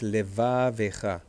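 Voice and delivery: "levavecha" slowly as le-VAA-vecha; stress on VA. Shown as a demonstration. Teacher correcting counterintuitive word for students, stress on VA. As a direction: lean long on the VA of "levavecha". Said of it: "it may feel or sound counterintuitive".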